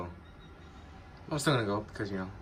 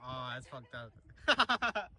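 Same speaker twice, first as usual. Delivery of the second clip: ordinary delivery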